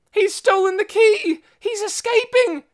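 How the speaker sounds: very high, loud wail; shrill alarmed cry. A narrator's reading. Minnie waking the Trogs to the escape, high loud cry. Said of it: "she cried".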